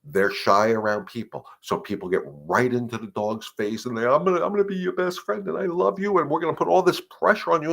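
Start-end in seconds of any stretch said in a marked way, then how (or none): 4.1-7.7 put-on high, fast gushing baby talk; mock the pushy owner smothering the dog. Dog trainer mocking an owner who crowds a shy dog, high and gushing.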